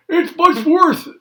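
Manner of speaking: high, loud, slow lament; distraught Grandpa. Acted. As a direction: wail like a toothless grandpa in despair.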